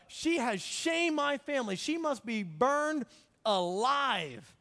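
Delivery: mock indignant condemnation, slowing at the end; self-righteous outrage. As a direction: declare the sentence with puffed-up righteousness. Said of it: "gets all bowed up inside and gets real self-righteous".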